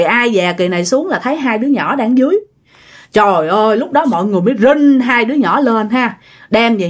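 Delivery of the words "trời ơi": ordinary delivery, nothing marked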